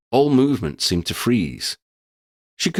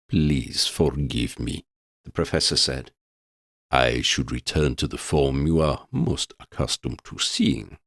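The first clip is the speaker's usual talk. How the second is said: courteous professor; 7 semitones lower, 3 dB quieter than usual. A narrator's reading. The professor apologises to Lydia, low and formal. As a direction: speak with old-world courtesy, deep.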